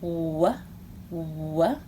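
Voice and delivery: quiet "W-" repeated; soft letter sound "w-". Shown as a demonstration. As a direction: model the "w-" sound softly. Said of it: "Make the sound with me".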